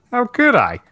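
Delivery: high, startled blurt. Acted. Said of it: "Chris blurted".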